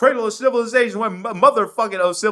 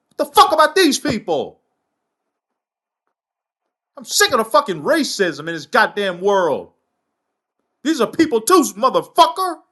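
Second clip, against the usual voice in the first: real anger. Real, not acted. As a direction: let the rage out, no apology. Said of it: "I don't apologize for my anger".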